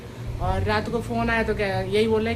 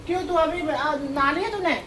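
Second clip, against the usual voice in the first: calling out; 7 semitones higher, 6 dB louder than usual. Real, not acted. Aunt loudly asking her nephew if he bathed. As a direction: call across the room to the nephew.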